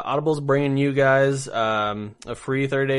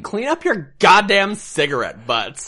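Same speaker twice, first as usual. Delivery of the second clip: slow, louder, higher complaint; angry housemate; 8 semitones higher, 6 dB louder, slower than usual. Retold or imitated. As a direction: yell at a messy roommate.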